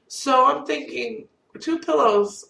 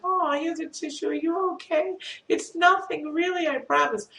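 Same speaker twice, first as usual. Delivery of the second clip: higher, gentle reassuring nurse voice; comforting nurse; 8 semitones higher than usual. Retold or imitated. Nurse comforting the tearful patient, higher and gentle. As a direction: soothe her like a kind nurse.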